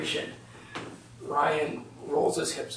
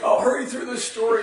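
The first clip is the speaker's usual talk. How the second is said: said through a laugh, higher; 7 semitones higher, 4 dB louder than usual. Real, not acted. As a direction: chuckle while promising to speed up. Audience laughs about anxiety.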